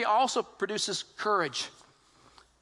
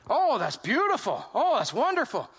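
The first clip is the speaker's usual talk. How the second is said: mock admiration. Admiring the emperor's invisible suit, hammed-up gushing. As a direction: gush over it in mock wonder.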